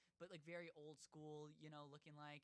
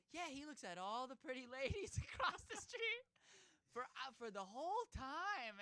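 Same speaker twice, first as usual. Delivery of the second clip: high, louder imitation of the woman; nonchalant barber woman; 7 semitones higher, 5 dB louder than usual. Barber woman tells teen about a customer, imitated nonchalance. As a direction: play her matter-of-fact, pitched well up. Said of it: "the nonchalance with the way this lady".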